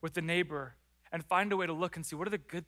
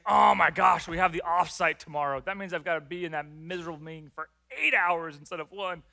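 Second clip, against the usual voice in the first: dreading complaint; 9 dB louder than usual. Pretend. Acted dread of an offsite.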